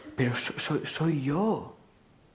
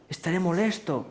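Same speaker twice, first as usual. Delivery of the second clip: normal talking voice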